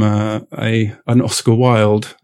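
usual speaking voice

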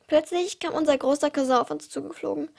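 ordinary delivery

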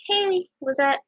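high, cheery retold hello; cheerful greeting. Girl greeting a classmate at school, cheerfully. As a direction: call out a cheerful hi. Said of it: "I was, like, cheerful and stuff".

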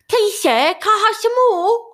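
put-on high, pleading British urchin accent; Oliver Twist British accent. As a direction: beg like a London street orphan in a British accent. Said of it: "very Oliver Twist British accent".

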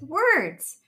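high, loud exclamation; gasped discovery. A narrator's reading. Rabbit finally seeing the writing, excited gasp. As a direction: blurt it in sudden realization. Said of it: "he gasped".

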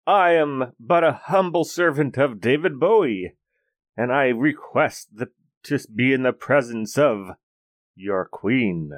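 grand courtly speech. Acted. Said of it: "I go".

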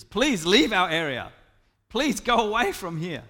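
loud, emphatic plea; pleading. Acted. Townspeople begging Jesus to leave their area, loudly pleading. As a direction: beg them forcefully to leave. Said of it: "The people plead with Jesus".

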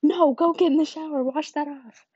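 high, loud alarmed mother; alarmed mom. Retold or imitated. Mom ordering her daughter to wash off the toothpaste, high and loud. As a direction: order it off her at once, urgently.

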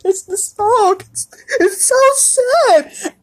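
put-on high, slow whimper of a small child; mock crying child. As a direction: whine it like a crying four-year-old. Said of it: "I was like".

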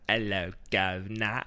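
slow mock English accent; hammed-up English greeting. Shown as a demonstration. Co-host checking how English the accent goes, mock English accent. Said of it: "How English? Are we talking, like".

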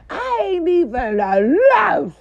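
high, loud and slow; outraged high yell; heated outburst. Real, not acted. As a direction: yell it in disbelief. Heated rant, voice jumps up.

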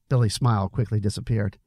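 ordinary delivery, nothing marked

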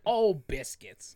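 drawn out mild exclamation; mild dismay. Shown as a demonstration. Dad demoing his clean swear, mildly annoyed. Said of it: "you just say, like".

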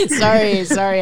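laughing out an apology; sorry through a loud laugh. Real, not acted. Laughing at the analogy joke.

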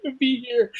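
high, fast, teary whine; imitating crying. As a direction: whimper it like a crying kid. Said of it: "I'm like, crying".

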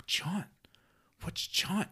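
put-on high, puzzled kid voice echoing the word; high confused little boy asking about a word. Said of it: "he was like".

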